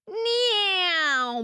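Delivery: high squeaky cat meow. A narrator's reading. Storyteller making a cat noise, high meow.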